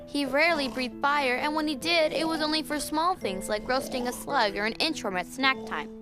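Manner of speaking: gentle storybook narration; reading to a toddler. A narrator's reading. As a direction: read it like a bedtime story.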